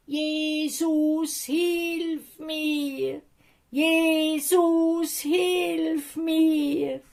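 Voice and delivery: high, loud desperate calling out; calling loudly. Acted. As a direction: cry out for help across the crowd. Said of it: "ganz laut angefangen zu rufen". (ganz laut rufen, hoch und flehend)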